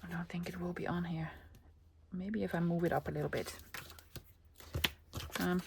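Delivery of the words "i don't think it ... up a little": soft unvoiced whisper; hushed aside while gluing. Real, not acted. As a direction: murmur it under her breath.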